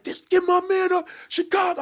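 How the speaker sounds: high mock crowd cheer. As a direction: call out like a hyped audience. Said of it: "they was like".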